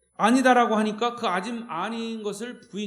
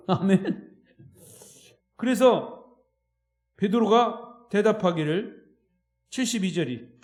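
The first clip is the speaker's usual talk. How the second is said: ordinary delivery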